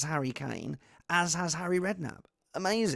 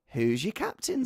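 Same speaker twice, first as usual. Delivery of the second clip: usual speaking voice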